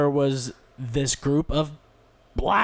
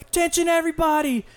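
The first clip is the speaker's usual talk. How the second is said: much higher, calling out to a big crowd; announcing to the crowd; 11 semitones higher than usual. Retold or imitated. Telling the crowd the kid will crowd surf, higher announcing call. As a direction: announce it like a self-made MC. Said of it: "And I was like".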